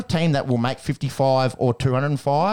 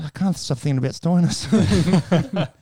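slow, besotted mock-earnest; dreamy confession; 4 semitones higher, 3 dB louder, slower than usual. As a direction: drawl it like a lovesick fan. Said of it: "I said".

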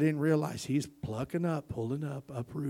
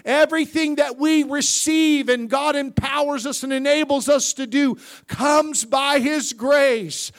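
high, loud, building sermon cry; full-force preaching; 7 semitones higher, 8 dB louder than usual. Acted. Preacher rousing the church about grace, shouting. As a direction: preach it to the rafters.

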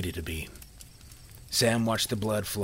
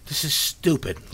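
raised, frantic yell; 4 semitones higher, 4 dB louder than usual. A narrator's reading. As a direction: yell it out in panic. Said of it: "Little yelled out".

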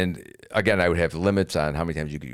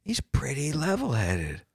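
drawn out and a touch higher; calm admirer voice; 5 semitones higher, slower than usual. Retold or imitated. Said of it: "everyone's like".

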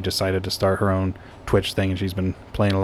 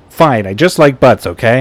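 defensive outburst; 3 semitones higher, 8 dB louder than usual. Real, not acted. Defensive outburst about liking butts.

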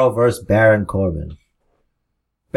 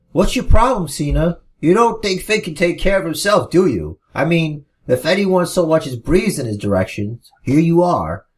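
mocking heel taunt; 7 semitones higher than usual. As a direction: needle him with a whiny sneer. Miz mocking Cena in promo.

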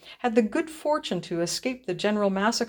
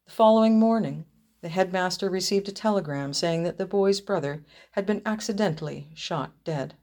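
usual speaking voice

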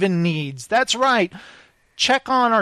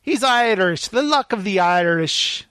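mock Irish accent. Host tries out an Irish accent for his co-host's approval. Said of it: "do I do a good Irish accent?".

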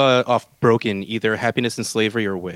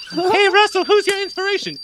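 squeaky high-pitched interviewer voice; high falsetto voice; 4 dB louder than usual. Pretend. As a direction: pitch way up like a fan. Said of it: "my alter ego".

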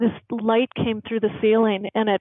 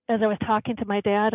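ordinary delivery

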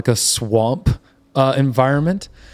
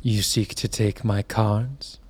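low spirit voice; 3 semitones lower, 8 dB quieter, slower than usual. Acted.

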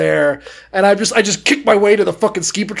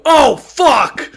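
higher, loud cry of dismay; alarmed yell; 7 semitones higher, 5 dB louder than usual. Retold or imitated. Kid watching his ski fall off the lift, dismayed yell. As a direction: curse loudly at a sudden disaster.

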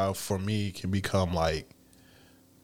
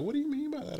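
curious woman; 5 dB quieter than usual. Retold or imitated. Voicing women on dating app.

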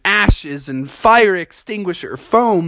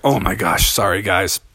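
big bear voice; 9 semitones lower than usual. Acted. Grizzly bear says sorry to his small friends, low. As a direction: drop into a deep bear rumble.

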